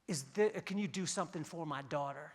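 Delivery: low, hushed urgent plea; a mother pressing in close on a stranger; whisper shout. Retold or imitated. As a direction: lean in and hiss it close. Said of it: "whisper shout at you".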